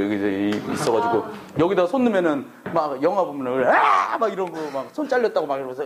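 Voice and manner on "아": high, loud mock scream of pain; hammed-up horror-film shriek. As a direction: yelp like a movie victim losing a hand. Imitating a movie scream.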